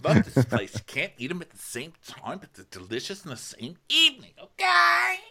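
gushing caricature, ending in a squeal; hammed-up enthusiasm; Ramona. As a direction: swoop up to a piercing last word. Acting Ramona gushing about the place.

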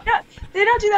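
giggly denial; laughing no. Real, not acted. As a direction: answer while still laughing. Laughing answer.

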